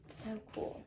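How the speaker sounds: whispered in wonder; hushed, marveling whisper; awed whisper. Real, not acted. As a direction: breathe it out amazed.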